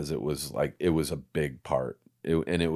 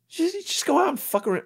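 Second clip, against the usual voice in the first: high, breezy quoted voice; voicing a friend. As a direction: toss it off like a carefree boss. Boss Sarah quoted in story.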